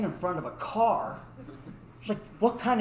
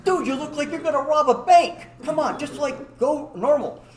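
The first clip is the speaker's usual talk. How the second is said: high, loud exasperated prod; exasperated, pitched-up telling-off; exasperated urging; 8 semitones higher, 6 dB louder than usual. Retold or imitated. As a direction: urge a jittery friend to act natural. Scolding jumpy friend in retold scene.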